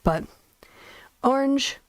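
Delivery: ordinary talk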